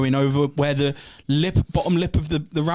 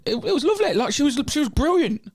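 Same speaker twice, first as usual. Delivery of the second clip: laughing; 12 semitones higher than usual. Real, not acted. Laughing praise.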